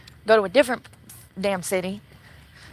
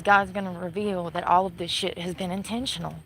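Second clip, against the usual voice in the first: normal talking voice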